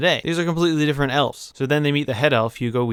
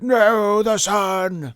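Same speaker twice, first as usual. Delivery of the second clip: high, put-on wail; mock horrified trolls; 9 semitones higher, much slower than usual. Trolls caught by sunlight, theatrical high wail. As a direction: cry out in hammed-up dismay. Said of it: "the trolls are like".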